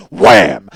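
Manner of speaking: a punch slamming metal; loud wham. Retold or imitated. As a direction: bark the impact like a sound effect. Imitated crash of punch.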